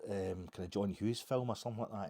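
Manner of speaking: ordinary delivery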